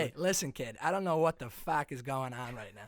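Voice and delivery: broad Boston tough talk; Massachusetts accent. Acted. Drunk masshole squaring up to a stranger, Boston accent. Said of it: "I just mastered the guy's accent".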